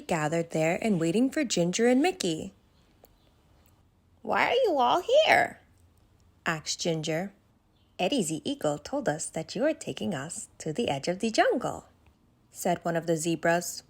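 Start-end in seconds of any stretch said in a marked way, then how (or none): 4.2-5.6 much higher, curious giraffe voice; a kind, worried young giraffe asking questions; Ginger the giraffe. Acted. Ginger surprised at crowd. 8.0-11.9 lightly voiced softer zebra; an animal explaining to Ginger. A zebra answers.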